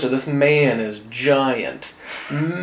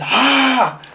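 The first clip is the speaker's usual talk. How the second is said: high, raw wordless yell; a sword-waving man screaming at the lady; mock scream; 9 semitones higher, 4 dB louder than usual. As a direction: let out a short mock war cry. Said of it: "just screaming".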